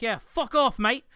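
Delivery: mock Aussie bloke. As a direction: wave off a crocodile like a tough Australian. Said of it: "you're just like".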